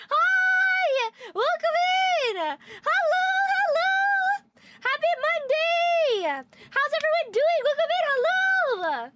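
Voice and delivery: high, loud sing-song welcome; excited greeting. Real, not acted. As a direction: beam out a welcome to everyone. Greeting new chatters, high and loud.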